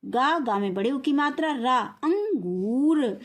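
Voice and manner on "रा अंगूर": slow teaching demo. Shown as a demonstration. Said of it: "अनुस्वार लगा देंगे तो क्या हो जाएगा?".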